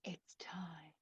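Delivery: hushed tragic whisper; ominous whisper. Acted. Judge whispering that the time has come, tragic. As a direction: breathe it out as a fateful omen. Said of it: "Greek tragedy".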